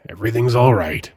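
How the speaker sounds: ordinary delivery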